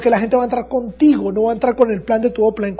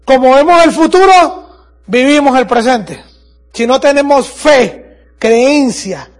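rousing slow proclamation; 5 dB louder, slower than usual. Acted. Motivational speech climax.

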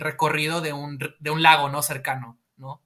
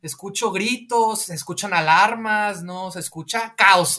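distressed; 4 semitones higher, 6 dB louder, slower than usual. Retold or imitated. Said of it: "Y Clark es como".

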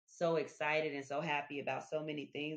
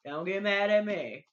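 louder, teasing don't-be-mad; playful loud plea; 5 semitones higher, 9 dB louder than usual. Acted. Teasing her viewers about church, playful louder plea.

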